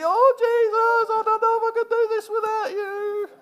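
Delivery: pleading like a helpless believer; whining believer. Retold or imitated. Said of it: "we stand there going".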